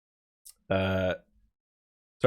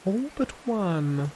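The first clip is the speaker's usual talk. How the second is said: slow, soft ominous repeat; mock dramatic echo; 7 semitones higher, 8 dB quieter, slower than usual. Streamer echoes the game's line, softer and slow.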